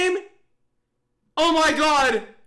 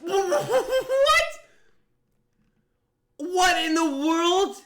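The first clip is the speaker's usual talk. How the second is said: ordinary talk, nothing marked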